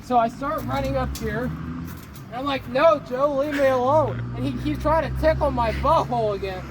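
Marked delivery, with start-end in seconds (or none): 2.7-4.2 loud, raised-pitch plea; mock protest. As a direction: cry out to be left alone, hammed up. Acting out plea to Joe.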